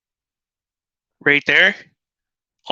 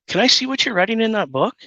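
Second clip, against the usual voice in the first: lightly voiced quote, higher and quick; re-enacted question; 8 semitones higher, faster than usual. Parent asking to see the note-taker's book, re-enacted question. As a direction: replay the polite request in a higher pitch.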